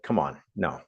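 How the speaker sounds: low, scoffing "come on, no"; mock groan of disbelief. Audience member scoffing at the speaker's advice, low and dismissive. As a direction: brush the idea off with a dismissive grumble. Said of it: "And you're like".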